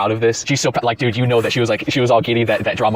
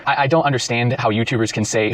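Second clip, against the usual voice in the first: ordinary talk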